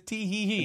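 mock coy giggle, hand over mouth; shy covered giggle. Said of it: "He covers his mouth and says".

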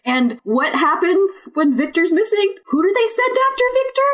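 rising very high exasperated question; exasperated rant. Real, not acted. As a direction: vent outrage at the script. Ranting about the plot.

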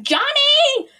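high, loud calling scream "Johnny!"; screaming a name. Retold or imitated. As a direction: scream out for the lost boy. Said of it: "like, screaming".

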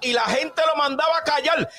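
high impassioned preacher's voice; fervent preaching. Acted. Pastor retelling the gospel story, impassioned preaching. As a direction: keep the sermon's fire up.

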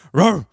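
short, loud imitated bark; punchy mock woof; dog bark. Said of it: "and the dog says".

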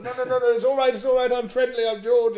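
very high, loud, rapid gabble; frantic reassurance. Retold or imitated. Intruder to a screaming woman he fell on, frantic panicked reassurance. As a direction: babble loudly that you mean no harm.

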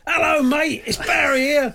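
high, hearty shouted hello; mock phone greeting. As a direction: call out high like an over-keen caller. Acting out a chairman's phone call.

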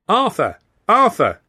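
high, loud urgent call of a name. A narrator's reading. Woman calling her runaway boy, loud and urgent. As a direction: call out for a lost child. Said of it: "A woman's voice was calling urgently".

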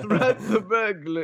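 slow, giggling through the words; can't get it out for laughing. Real, not acted. Retrying the phrase she botched, laughing as she says it. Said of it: "I like the way you said it".

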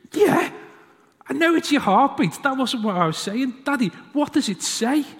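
put-on a child impatient with dad; indignant six-year-old, high and loud; insistent little boy.

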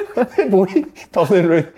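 lachend vertellen met hoge stem; laughing. Real, not acted. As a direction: lach door de woorden heen. Laughing while telling the story.

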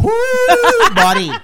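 high, loud whoop with a laugh; fired-up whoop. Real, not acted. Host fired up about soccer, excited whoop. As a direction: let out a big excited holler.

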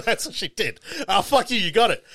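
laughing high and loud. Real, not acted. Storyteller laughing as friend guesses the twist. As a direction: crack up as you confirm it.

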